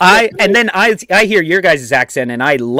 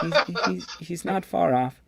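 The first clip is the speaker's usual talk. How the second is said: hushed retold realisation; muted aside; 13 dB quieter than usual. Repeats retold aside after laugh.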